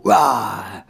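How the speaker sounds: loud mock roar; big falling growl; bear roar. Bears growl at girl.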